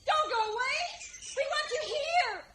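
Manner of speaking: high, loud crying plea; desperate call. Acted. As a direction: cry out for him to stay. Calling after Tarzan.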